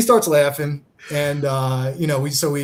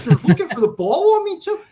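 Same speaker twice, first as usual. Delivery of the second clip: high, laughing mock outrage; laughing indignation; 5 dB louder than usual. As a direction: laugh through the complaint. Acted indignant thought.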